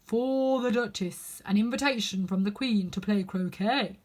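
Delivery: slow, loud, solemn footman announcing; grand solemn proclamation. A narrator's reading. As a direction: intone it like a pompous footman. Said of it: "saying in a solemn tone".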